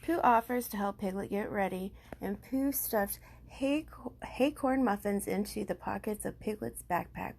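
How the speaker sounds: ordinary delivery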